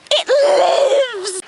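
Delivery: high laughing shout; joyful shout. Real, not acted. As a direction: cheer with relief. IPod survived the fall.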